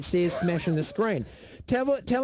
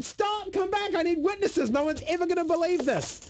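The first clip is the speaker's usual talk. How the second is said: retold desperate shout, pitched way up; mock yelling; 11 semitones higher than usual. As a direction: call after fleeing witnesses in a strained high voice. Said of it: "I was yelling at them".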